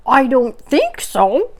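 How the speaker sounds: slow disguised voice; different sort of voice. Acted. Rabbit disguising voice from Pooh, slow. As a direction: pretend not to be yourself. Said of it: "in a different sort of voice".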